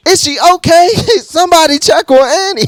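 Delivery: very high, loud, laughing falsetto; laughing mock song. Fan jokes about Annie, singing high and laughing. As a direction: ham it up through laughter.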